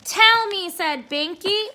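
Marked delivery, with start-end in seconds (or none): 0.0-0.7 bossy demand. Acted. Binky demands the secret words.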